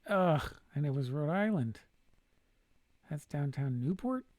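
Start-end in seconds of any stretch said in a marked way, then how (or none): 0.1-1.8 loud, exasperated ugh at the miss; frustrated groan. Real, not acted. Player groaning at a missed guess, loud frustration. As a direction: vent the annoyance at a wrong guess.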